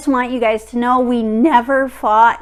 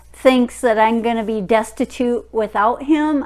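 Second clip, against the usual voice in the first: ordinary delivery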